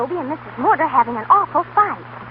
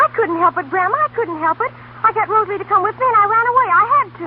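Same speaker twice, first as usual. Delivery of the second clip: higher, rising, pleading excuses to grandma; anxious pleading; 6 semitones higher than usual. Acted. Girl pleads with her grandmother.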